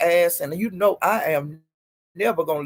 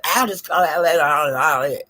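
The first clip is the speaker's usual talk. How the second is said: mocking Ness; 2 semitones lower, 5 dB louder than usual. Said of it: "she brought it".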